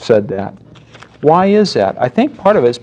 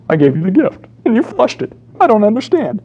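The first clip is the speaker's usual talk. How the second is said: put-on much higher, small child's puzzled voice; hurt toddler; 10 semitones higher than usual. Toddler asking the parent why his gift was flushed, high and puzzled. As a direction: sound like a let-down little kid.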